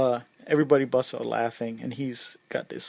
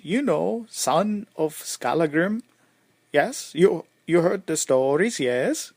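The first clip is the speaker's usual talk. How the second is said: higher, louder, prompting Swedish lilt; Swedish accent; 4 semitones higher, 6 dB louder than usual. Acted. Warrior expecting strangers to know his name, Swedish accent. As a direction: fish for recognition in a Swedish accent. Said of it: "sorry, that was my Swedish, uh, accent".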